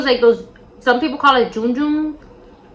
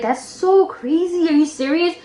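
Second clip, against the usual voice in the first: slower, higher mock amazement; mild amazement; 6 semitones higher, slower than usual. Best friend supporting her craziness, mildly amazed. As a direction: imitate a supportive friend marvelling. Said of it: "she laughed a little bit".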